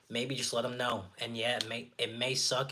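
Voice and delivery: ordinary talk, nothing marked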